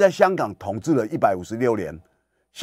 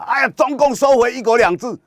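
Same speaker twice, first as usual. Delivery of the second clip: much higher, louder whiny protest; mock whining complaint; 12 semitones higher, 4 dB louder than usual. Commentator mimics Hong Kong protesters, high mocking whine. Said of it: "天天骂".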